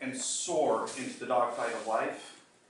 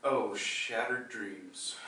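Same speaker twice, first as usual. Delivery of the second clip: softer and slow, a sigh of shattered dreams; mock lament; 5 semitones lower, 6 dB quieter, much slower than usual. Speaker mourning the student's lost hopes, slow and soft.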